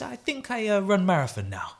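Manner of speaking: high, playful imitation of a runner; halting, playful foreign-runner voice; Emil Zatopek. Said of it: "with this playful kind of".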